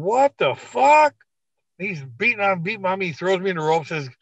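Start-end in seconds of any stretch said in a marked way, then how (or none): 0.0-1.1 high, loud, slow stunned cry; shocked outburst. Retold or imitated. Attacked before the bell, stunned and shouting at the opponent. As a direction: drag it out in disbelief.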